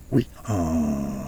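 lightly brayed oui like a donkey; a hint of a donkey's hee-haw. A narrator's reading. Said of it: "plus fort que l'âne encore".

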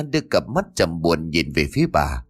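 plain reading voice

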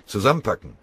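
laut gerufenes „Zusammenpacken!“; lauter Befehl. Acted. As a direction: kurz und energisch befehlen. Befehl zum Aufbruch. (Befehl an die Männer, laut gerufen)

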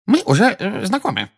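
very high, squeaky little voice; excitable squeak. A narrator's reading. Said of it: "пропищал чуть что приходящий в волнение Дингл".

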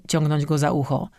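ordinary delivery

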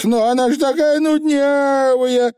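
high, whining sigh; sighing Feegle. A narrator's reading. Feegle grumbling that truth is boring, sighing. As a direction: sigh out a little creature's complaint. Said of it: "вздохнул Явр заядло".

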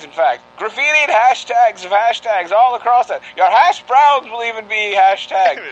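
put-on old-time radio campaign read; rally the public like a newsreel; 1940s radio announcer. Mock public service campaign.

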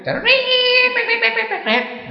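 high squealing mock train brakes; imitated brake screech.

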